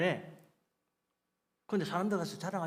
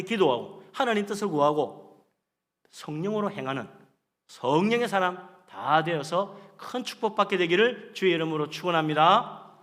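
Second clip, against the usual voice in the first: loud, stretched-out proclamation of blessing; preaching at full force; 9 dB louder than usual. Real, not acted. Preacher blesses congregation to live by faith, loud exhortation.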